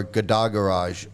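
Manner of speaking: "Gada" said guh-DAH, stress on the end; stress on second syllable. Shown as a demonstration. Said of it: "however you wanna pronounce it".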